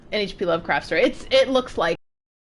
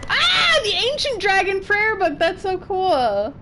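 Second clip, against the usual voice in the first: high-pitched delighted exclamation; thrilled, high-pitched geeking out; excited discovery; 9 semitones higher than usual. Real, not acted. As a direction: gasp out high with real delight at a find. Delighted at finding an item.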